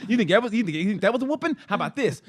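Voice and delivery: mock angry mom. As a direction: throw it at the kid like a mad mom. Mom voiced mid-beating.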